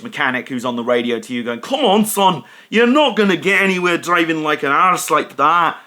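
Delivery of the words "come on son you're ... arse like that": hammed-up Scottish accent, higher and a bit louder; terrible Scottish accent. Scottish race engineer nagging the driver over the radio, in a terrible Scottish accent. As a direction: bark it like a grumpy Scottish mechanic in a bad Scottish accent. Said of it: "in such a terrible Scottish accent".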